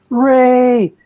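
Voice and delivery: high, loud imitated scream of a woman being arrested; mock scream. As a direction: shriek it as a fake victim. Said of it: "starts screaming".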